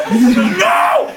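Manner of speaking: high mock-berserk yell; Hulk-style freak-out. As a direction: cry out in a hammed-up rage. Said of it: "freaks out and just goes Hulk and goes".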